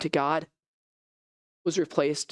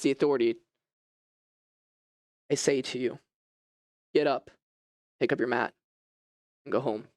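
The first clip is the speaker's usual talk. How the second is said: lightly voiced Jesus, lower and calm; a hint of a gentle, solemn Jesus; 4 semitones lower than usual. Jesus addresses the crowd.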